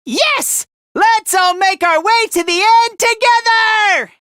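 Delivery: rally them with full-voiced enthusiasm; rousing rally cry. Urging all to go on together, rousing cry.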